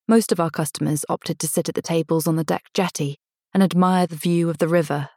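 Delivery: plain reading voice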